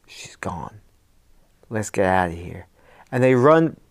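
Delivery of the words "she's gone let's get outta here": whispered, scared. Retold or imitated. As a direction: whisper so the witch won't hear. Boys hiding from witch.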